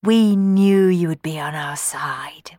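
slow whispered line. Acted. Johnny leading Rowan to the cupboard, whispering. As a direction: whisper it conspiratorially. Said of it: "whispered Johnny".

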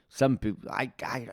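ordinary delivery

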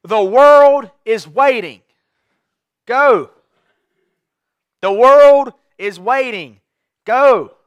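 loud, slow, drawn-out proclamation; forceful preaching call. Acted. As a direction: proclaim it slowly to the whole church, full voice. Preacher's rallying call to church.

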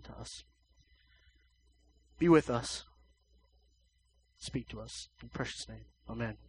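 soft, hushed prayer voice. Acted. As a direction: pray quietly over the congregation. Leads a short prayer.

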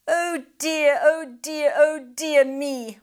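high, slow lamenting bird voice; sighing pelican. Acted. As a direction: groan it out like a sorry old bird.

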